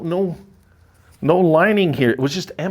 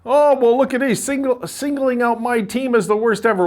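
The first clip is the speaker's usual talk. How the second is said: mock whiny fan; 12 semitones higher than usual. Mocking fans who complain he singled out their team, high whiny voice. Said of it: "for all of you that are complaining".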